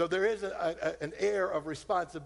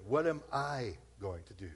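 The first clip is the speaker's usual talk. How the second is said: usual speaking voice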